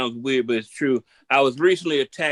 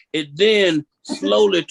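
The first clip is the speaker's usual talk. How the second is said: normal talking voice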